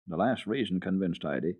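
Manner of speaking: ordinary delivery, nothing marked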